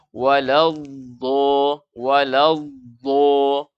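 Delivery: WALADDO drawn out slowly with a heavy, rounded DDO; dha tebal. Shown as a demonstration. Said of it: "dengan mengangkat pangkal lidah". (WALADDO dibaca tebal, pangkal lidah diangkat)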